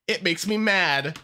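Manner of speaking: normal talking voice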